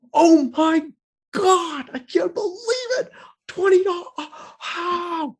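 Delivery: almost crying with happiness; overjoyed disbelief. Retold or imitated. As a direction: homeless man thrilled at the twenty dollars. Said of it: "he was so excited".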